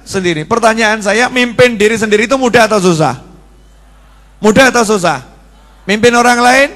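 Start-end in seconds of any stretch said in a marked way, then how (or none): none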